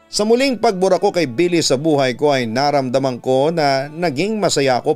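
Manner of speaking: usual speaking voice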